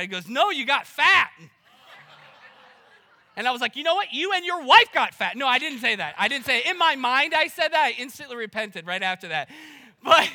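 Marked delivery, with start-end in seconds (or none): 0.3-1.3 louder, blunt mock jab; blunt retort. Stranger's blunt retort retold. 3.8-5.4 higher, louder fake comeback; mock comeback. Imagined comeback, denied after.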